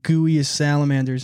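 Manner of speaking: ordinary delivery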